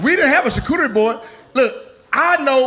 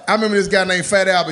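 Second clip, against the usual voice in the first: ordinary talk, nothing marked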